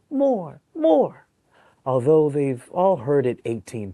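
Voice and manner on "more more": high, loud pleading; begging bunnies. Acted. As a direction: beg like eager little bunnies. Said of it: "all the bunnies beg".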